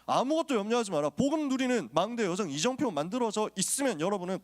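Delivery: fervent preacher urging the flock on; preaching at full force. Acted. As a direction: press each promise home from the pulpit. Climax of sermon.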